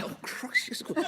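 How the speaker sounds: breathy then high laughing "of course"; sudden realization, laughing; dawning panic. Retold or imitated. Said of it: "And I".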